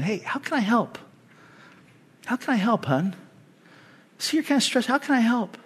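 soft, caring husband's question; husband softly asking his stressed wife how to help; gentle offer. Acted. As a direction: ease her stress softly.

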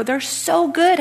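high, enthusiastic self-quote; retold gushing. Speaker tells lemon-giving neighbor about oranges, retold high gushing. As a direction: gush about it, pitched well up.

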